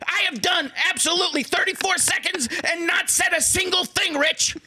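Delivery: high, shouted, breathless coach voice; Steve Mariucci impression. Imitating Mariucci on air with Rich, high shouting. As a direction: yell it way up high without breathing. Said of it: "I'm not even sure what this accent is exactly".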